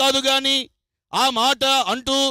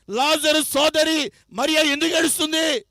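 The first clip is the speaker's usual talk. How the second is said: normal talking voice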